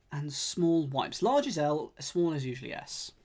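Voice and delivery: ordinary talk, nothing marked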